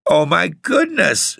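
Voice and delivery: high, slow cry of dismay. A narrator's reading. Elsie smelling the gloves, crying out in dismay. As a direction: gasp it out in alarm. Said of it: "she cried".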